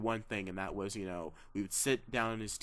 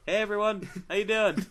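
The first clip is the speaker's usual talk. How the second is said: put-on higher, louder chipper teen greeting; kid voice; 9 semitones higher, 5 dB louder than usual. Mimicking young Tom Holland, higher and louder. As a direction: bounce it like a teen fan. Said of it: "he sounds like me".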